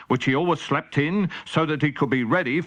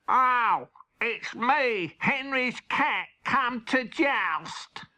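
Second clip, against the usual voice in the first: Henry's cat; 8 semitones higher, 3 dB quieter, much slower than usual. Acted. Henry's cat replies to guard.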